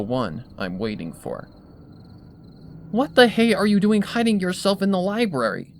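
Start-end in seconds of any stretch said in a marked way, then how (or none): 2.9-5.7 higher, louder, accusing tomboy voice. A narrator's reading. Rainbow accusingly questioning Twilight at the library door. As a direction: call her out for hiding. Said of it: "she asks accusingly".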